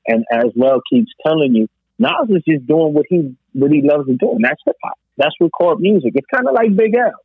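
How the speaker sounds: ordinary delivery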